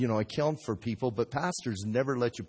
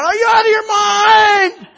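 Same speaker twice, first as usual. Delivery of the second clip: very high, drawn-out shriek; mock panicked scream; 19 dB louder, slower than usual. Pots screaming at potter over kiln heat, hammed-up panicked shriek. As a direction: scream it as if being roasted alive.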